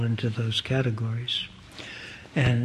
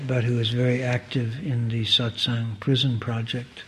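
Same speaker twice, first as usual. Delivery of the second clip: usual speaking voice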